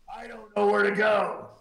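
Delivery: high-pitched helpless whine, acted out; imitating a flustered driver; flustered mock protest. Frank repeats himself, flustered, retold.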